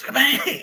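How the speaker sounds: high, loud cartoon "kabang"; imitated blast. Using the force at the last second, imitated blast. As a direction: shout a swooping explosion noise.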